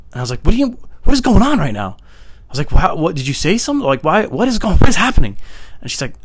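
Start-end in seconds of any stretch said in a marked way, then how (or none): 0.4-2.0 loud, high-pitched disbelief; exasperated, incredulous demand; bewildered outburst. Retold or imitated. Retold bewildered confrontation. 2.7-5.4 high, increasingly loud disbelief; flustered questions building in volume; bewildered outburst. Retold or imitated. Retold bewildered confrontation.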